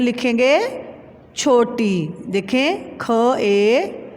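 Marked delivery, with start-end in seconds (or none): 3.0-4.0 slow, drawn letter-by-letter spelling. Shown as a demonstration. Teacher spelling a word for pupils, slow and drawn out. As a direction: sound out each letter slowly with long gaps. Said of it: "क्या लफ्ज़ बना".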